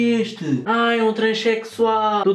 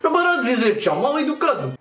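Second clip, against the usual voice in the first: put-on broken-Portuguese Russian accent; 6 semitones higher than usual. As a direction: play a lost Russian, heavy accent. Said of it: "com a famosa voz de russo".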